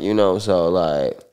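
ordinary delivery

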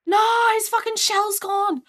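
loud, high, drawn-out yell; dismayed shout. Real, not acted. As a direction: wail in protest at the loss. Dismay at lost crab.

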